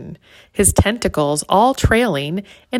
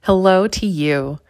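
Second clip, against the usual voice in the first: usual speaking voice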